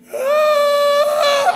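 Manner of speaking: high-pitched mock wail; sustained high cry of pain, acted; child's wail. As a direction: imitate a hurt child howling. Imitates child's wail after fall.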